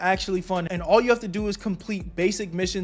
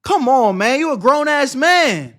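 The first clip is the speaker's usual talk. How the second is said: loud, high, grossed-out rant; disgusted yelling; 6 semitones higher, 9 dB louder than usual. Real, not acted. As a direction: yell in real disgust. Disgusted at snot on screen.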